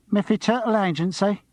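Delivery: put-on light Cockney accent on the phone; Cockney receptionist. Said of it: "Her voice was Cockney".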